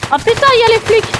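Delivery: high startled yelp; panicked yell at the screen; raging gamer. Real, not acted. Gamer spots the cops.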